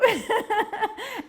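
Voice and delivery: high, loud burst of laughter; real laugh. Real, not acted. Teller amused by the lady's plea, hearty laugh.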